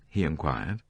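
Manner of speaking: ordinary delivery, nothing marked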